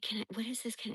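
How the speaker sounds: imitated hushed whisper; breathy whispered question with no voice; retold whisper. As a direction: whisper it as he snoops. Imitating him poking at her things.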